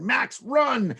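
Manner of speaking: high, loud cry to run; urgent shout. Acted. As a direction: yell a warning to flee. Sister warns of cat.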